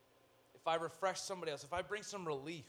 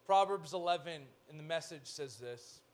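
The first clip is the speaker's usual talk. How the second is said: ordinary delivery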